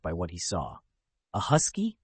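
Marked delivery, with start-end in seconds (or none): 1.3-2.0 a startled little "A husky?", pitched high; muttered in surprise. A narrator's reading. Anduin, finding a husky in the cell, surprised. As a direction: caught off guard, blurting it out. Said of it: "he muttered in surprise".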